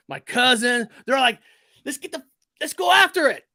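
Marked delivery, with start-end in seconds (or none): 1.8-3.4 higher, louder keen call to chase; eager rallying cry. Retold or imitated. Young cousins urging each other to chase the creature, excited. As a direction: fire the others up to run after it.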